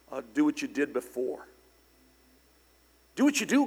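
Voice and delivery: ordinary talk, nothing marked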